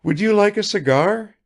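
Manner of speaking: high, eager lady's voice; hostess offers a cigar. A narrator's reading. Kukshina offers Bazarov a cigar, high and eager. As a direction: offer it brightly, a notch up.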